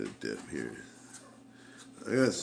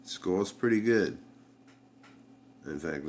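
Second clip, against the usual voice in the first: ordinary talk, nothing marked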